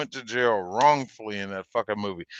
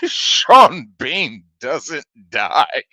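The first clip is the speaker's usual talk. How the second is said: laughing; 5 semitones higher, 5 dB louder, much slower than usual. Real, not acted. Punchline through laughter.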